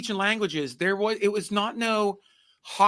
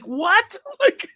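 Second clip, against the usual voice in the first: high, loud what; a startled yelp of disbelief; mock shock; 6 dB louder than usual. Imagined reaction to war-teaching.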